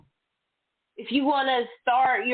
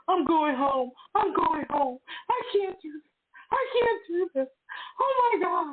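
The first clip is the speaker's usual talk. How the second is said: mock panic; 7 semitones higher than usual. Retells her panic attack in the car.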